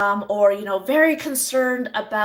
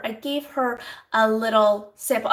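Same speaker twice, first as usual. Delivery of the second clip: ordinary talk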